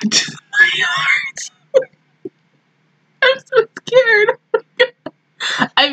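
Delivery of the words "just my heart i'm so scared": high, slow tearful whimper; heartbroken crying. Real, not acted. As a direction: drag the words out through sobs. Heartbroken and scared by episode.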